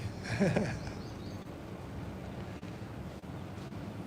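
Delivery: long breathless wheezing laugh; silent wheeze laugh. Real, not acted. Vlogger amused at the chatter joking, wheezing.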